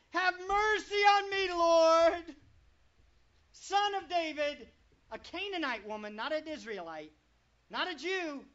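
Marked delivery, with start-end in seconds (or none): 0.1-4.7 loud pleading cry, "Lord" pushed hardest; crying out for mercy. Acted. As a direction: call out desperately to the Lord. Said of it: "began crying out, saying".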